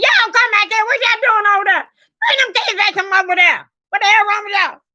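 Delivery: high, brisk scolding holler; country hollering. Retold or imitated. Wife hollering at kids at family picnic, country holler. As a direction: call the kids back from across the yard. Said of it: "I'm saying".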